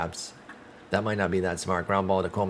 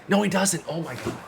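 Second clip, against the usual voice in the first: surprised; 10 semitones higher than usual. Real, not acted. Streamer watching his fielder miss, shocked. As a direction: blurt it in disbelief.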